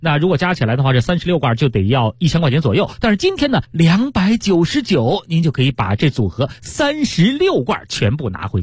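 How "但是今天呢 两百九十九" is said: high lead-in, then slow price; slow price reveal. Acted. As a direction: draw out the bargain price. Announcing the sale price.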